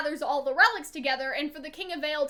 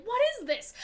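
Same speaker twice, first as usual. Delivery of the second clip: "What is this?" pitched higher, stunned; mock shock; 7 semitones higher than usual. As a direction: say it like he's seeing a war coming. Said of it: "It's not a reaction of".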